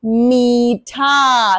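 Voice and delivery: मीठा with ee held very long; stretched long ee. Shown as a demonstration. Teacher demonstrates long ई in a word, drawn out. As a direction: drag out मीठा slowly. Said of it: "यहां हम ले लेते हैं".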